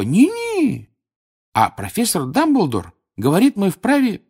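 high, solemn elf voice; squeaky little servant reciting elf rules; earnest house-elf. Acted. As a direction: play a dutiful elf, pitched high. Plays Dobby the house-elf.

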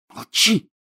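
high, whispered warning; urgent whisper. A narrator's reading. Girl stopping her angry friend, whispering urgently. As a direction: hush him in a sharp whisper. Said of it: "прошептала Гермиона".

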